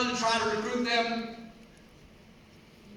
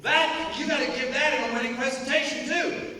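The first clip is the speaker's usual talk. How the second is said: normal talking voice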